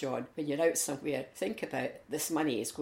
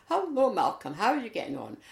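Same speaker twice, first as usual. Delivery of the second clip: hearty greeting; 7 semitones higher, 5 dB louder than usual. Retold or imitated. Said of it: "this chap came up to him and said".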